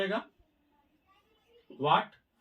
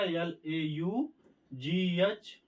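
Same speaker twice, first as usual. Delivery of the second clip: spelling aloud; much slower than usual. Shown as a demonstration. Spelling out laugh.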